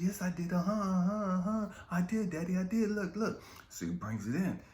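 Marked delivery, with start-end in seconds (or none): 0.0-3.4 put-on high eager child's voice; a son insisting to his dad that he finished; proud little boy. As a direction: insist like a proud small kid.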